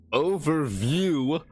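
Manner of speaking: overview drawn out slowly, every syllable spelled out; careful overview. Shown as a demonstration. Vlogger fighting to enunciate overview, slow and deliberate. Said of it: "I can't enunciate it".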